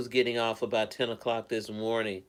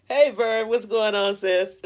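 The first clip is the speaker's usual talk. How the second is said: higher, louder happy hello; excited greeting; 9 semitones higher, 7 dB louder than usual. Real, not acted. Greeting sister Vern joining the livestream, excited and loud. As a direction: call out warmly to a friend arriving. Greeting a viewer who arrived.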